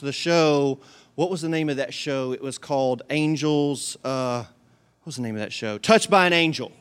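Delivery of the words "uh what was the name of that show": ordinary talk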